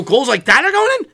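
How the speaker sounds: high, loud disbelief; incredulous shout. Retold or imitated. Fan recalling the goal to his friend, incredulous shout.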